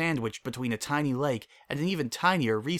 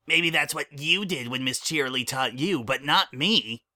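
put-on young colt voice; 2 semitones higher than usual. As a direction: play an eager little colt. Child character voice.

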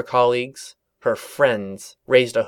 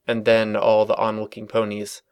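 ordinary delivery, nothing marked

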